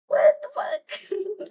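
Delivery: drawn out in real disbelief; baffled outburst. Real, not acted. Reader stunned by her own wording, drawn out in disbelief.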